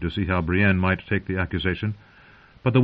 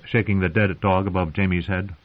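plain reading voice

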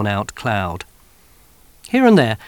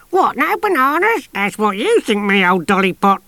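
much higher, crone's cackling voice; dotty old woman; 10 semitones higher, 5 dB louder than usual. Acted. Missus Tachyon mocking the men, high crone voice. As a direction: tease them like a batty old lady.

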